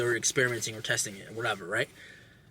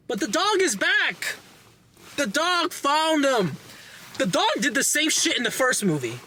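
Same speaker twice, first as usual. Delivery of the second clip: excited; 11 semitones higher than usual. Real, not acted. Reviewer cheering the dog's return, high thrilled outburst. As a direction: burst out delighted, pitched way up.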